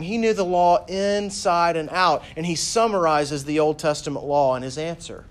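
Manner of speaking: usual speaking voice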